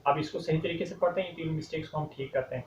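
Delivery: normal talking voice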